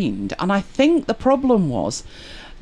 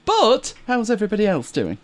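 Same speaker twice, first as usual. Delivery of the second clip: normal talking voice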